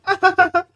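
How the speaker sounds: sudden loud high-pitched burst of laughter. Real, not acted. Laughing hard at his own bragging story.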